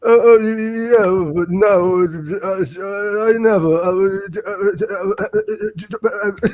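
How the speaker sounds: imitating [name] stammering through an interview; stuttering [name]. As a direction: stumble through every word like [name]. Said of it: "this is exactly how he said it".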